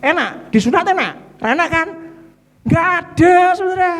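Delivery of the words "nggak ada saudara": high, loud emphatic shout. Real, not acted. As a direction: cry it out to the crowd. Preacher's emphatic declaration.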